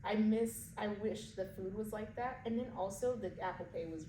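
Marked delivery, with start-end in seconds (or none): none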